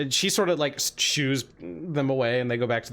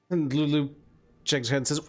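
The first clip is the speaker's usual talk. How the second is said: ordinary delivery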